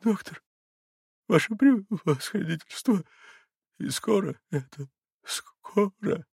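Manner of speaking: high, faltering question with broken pauses; halting, anguished high voice; fearful pleading. A narrator's reading. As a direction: beg timidly, hardly daring to ask. Crushed father begs the doctor.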